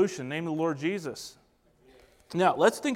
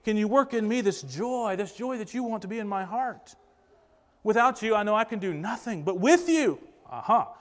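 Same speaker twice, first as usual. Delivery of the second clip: higher-pitched, pleading prayer; acted-out heartfelt prayer, raised in pitch; earnest prayer of faith; 4 semitones higher than usual. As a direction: plead with the Lord in repentant faith. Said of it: "Faith says".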